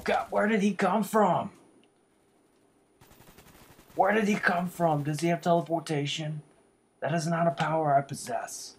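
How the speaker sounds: high, loud outburst at a surprise attack; frustrated yelling. Real, not acted. Gamer yells at an enemy who seems to teleport, loud and high. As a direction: yell it out, caught off guard. Ambushed in a match.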